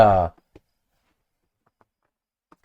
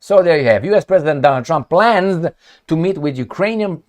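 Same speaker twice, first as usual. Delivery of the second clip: ordinary talk, nothing marked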